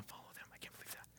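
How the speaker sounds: hushed, mock gossip whisper; conspiratorial whisper. Friend agreeing to unfollow someone, conspiratorial whisper.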